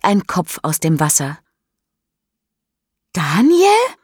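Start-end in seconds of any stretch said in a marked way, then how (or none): 3.1-4.0 high, loud startled call; a stunned, hopeful call; shocked surprise. Acted. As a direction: cry out his name in disbelief. Mischa sees head in water.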